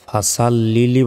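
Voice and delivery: ফাসাল্লি লি with thin "sa", joined as "fasallili"; mispronounced, run together. Shown as a demonstration. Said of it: "বলবেন না".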